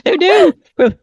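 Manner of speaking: high, bright "oh no" with a laugh behind it; playful alarm at the idea of sleeping at the wheel; mock dismay. Real, not acted. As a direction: sympathetic mock horror. Laughing reaction to sleepy driving.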